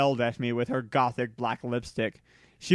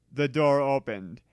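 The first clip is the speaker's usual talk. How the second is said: plain reading voice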